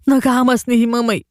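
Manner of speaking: a lightly sobbed complaint; sobbing. A narrator's reading. The boy sobs to his friends he does not want Bombay, mildly sobbing. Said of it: "मास्तर हुंदके देत म्हणाला".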